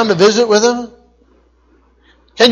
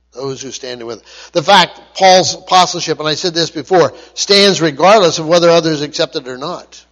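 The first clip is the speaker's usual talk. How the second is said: ordinary delivery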